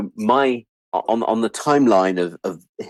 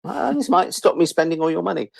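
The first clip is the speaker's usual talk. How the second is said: mock cheerful; 11 semitones higher than usual. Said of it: "And I'm going".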